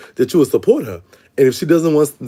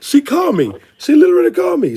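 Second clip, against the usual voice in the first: excited; 13 semitones higher, 4 dB louder than usual. Real, not acted. Excited news about Dominique.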